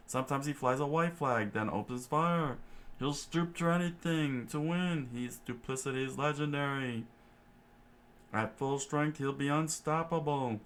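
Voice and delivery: high put-on chef voice, steady telling; exposition chef. Chef tells the story of Krieg, high character voice.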